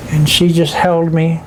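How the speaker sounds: ordinary talk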